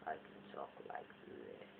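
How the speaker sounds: very low, hushed put-on voice; different voice. Shown as a demonstration. Showing viewers another voice, very low and hushed. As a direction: drop right down, almost voiceless. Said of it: "I do lots of different voices".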